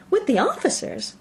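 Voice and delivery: high, thrilled question; excited cry. A narrator's reading. Lydia hearing the officers news, thrilled and high. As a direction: squeal it out. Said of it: "cried Lydia".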